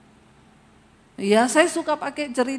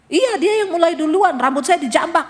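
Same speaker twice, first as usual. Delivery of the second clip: higher, louder indignant complaint; quoting indignant wife; 5 semitones higher, 4 dB louder than usual. Retold or imitated. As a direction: blurt it out defensively. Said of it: "Istrinya bilang". (istri yang membela diri dengan suara tinggi)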